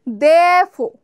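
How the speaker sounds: Therefore said "dh-eh-fo", r dropped; therefore demo. Shown as a demonstration. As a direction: swallow the r in Therefore, "defo". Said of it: "Repeat after me".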